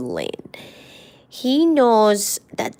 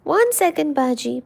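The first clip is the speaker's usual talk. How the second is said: high, sweet pleading to the cat; coaxing high voice to the cat; 8 semitones higher, faster than usual. Retold or imitated. Owner asking needy cat to wait, high coaxing voice. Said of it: "try to verbally be like".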